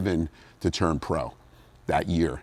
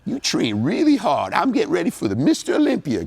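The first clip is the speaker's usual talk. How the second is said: put-on Lee Haney's Southern accent; 11 semitones higher, 3 dB louder than usual. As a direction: put on a high-pitched Southern twang. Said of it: "I had this Southern accent".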